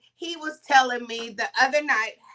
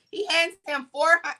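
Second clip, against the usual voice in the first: ordinary delivery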